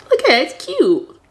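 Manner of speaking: light up as you hold the outline up to the camera; delighted showing off. Real, not acted. To viewers, proudly showing her cute outline, delighted.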